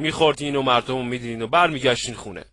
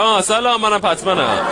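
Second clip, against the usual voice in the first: put-on higher and louder, light laughing girl's greeting; 6 semitones higher, 4 dB louder than usual. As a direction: chirp the hello as a playful party guest.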